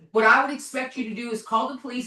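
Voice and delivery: ordinary talk, nothing marked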